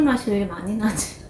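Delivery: talking into a glass; speaking while sipping drink. Real, not acted. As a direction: lips on glass rim. Seen 0: No clear chewing; from 1.8s she talks with the glass at her lips.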